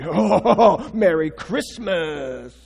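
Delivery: hammed-up affronted retort, stretched slowly; mock huffy indignation. Preacher mimicking shopper answering Happy holidays, mock huffy indignation. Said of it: "he'd get all huffy and, and indignant".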